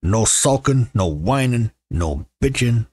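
mock-stern household decree; putting foot down. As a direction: lay down the law, one ban at a time. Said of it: "I just put my foot down".

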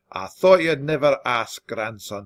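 ordinary delivery

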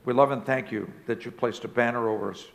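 unhurried, earnest pastoral prayer; closing prayer. Acted. Pastor praying for the congregation, earnest and unhurried.

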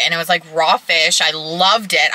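normal talking voice

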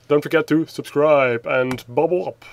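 usual speaking voice

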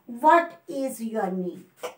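very slow and drawn out, falling at the end; slow read. Shown as a demonstration. Teacher modelling the question slowly for students. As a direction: stretch each word of "What is your name?" and let it fall. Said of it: "आपको क्या करना है".

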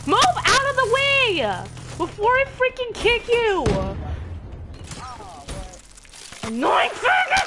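exasperated shout, pitched high; gamer rage. Real, not acted. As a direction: yell at whoever is in your way. Raging at players in the game.

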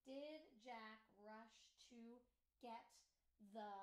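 very slow, word by word; a slow, careful sentence read-through. Shown as a demonstration. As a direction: read it out slowly, one word at a time. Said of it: "go back and remember".

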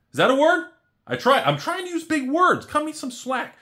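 high, loud playful self-teasing; self-mocking. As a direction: squeak it out like caught out. Joking about his own big words.

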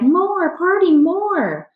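put-on very high, loud little-boy wail; crying out. A narrator's reading. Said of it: "He cried".

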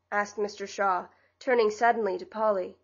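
ordinary delivery, nothing marked

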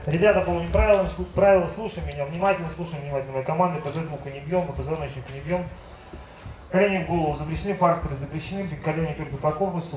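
fast, low rules briefing; referee instructions. Acted. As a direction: rattle off the rules quickly. Referee briefing fighters quickly.

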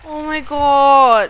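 drawn-out despair. Real, not acted. Teammate just died.

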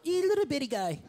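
put-on high, cooing baby-talk over a tiny car; high doting voice; cutesy coo. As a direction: coo at it like a cute pet.

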